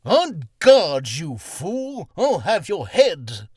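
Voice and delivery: loud haughty duelist challenge, slow on the threat; regal swashbuckler. Shown as a demonstration. Voice actor demonstrating a regal role, hammy loud challenge. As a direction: bellow it like a pompous swordsman. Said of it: "But you wanna go".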